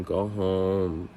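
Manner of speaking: usual speaking voice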